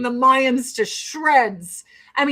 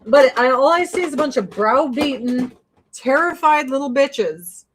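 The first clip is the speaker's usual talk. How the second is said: ordinary talk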